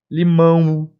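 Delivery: "Limão" with the final o drawn into a clear nasal U; final U sound. Shown as a demonstration. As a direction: glide "Limão" out to "limãũ". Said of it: "tem aquele sonzinho de U".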